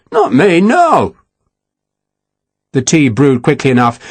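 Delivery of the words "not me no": higher-pitched, shocked protest; scandalized, high-pitched denial. A narrator's reading. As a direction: deny it in shock, pitch climbing sharply. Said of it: "Dodd said, shocked".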